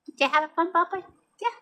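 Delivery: cute cat tone. Real, not acted. Owner asking her cat about his fun, cutesy. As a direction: coo a little question to your pet. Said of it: "the tone I use for my cat".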